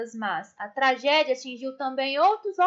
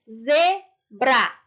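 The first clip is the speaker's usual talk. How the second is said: "Zebra" split into a strong ZE, a pause, then BRA; separated syllables; 4 dB louder than usual. Shown as a demonstration. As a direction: say "Zebra" in two detached syllables, leaning on ZE. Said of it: "separar as sílabas".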